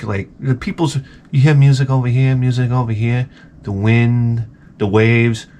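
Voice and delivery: usual speaking voice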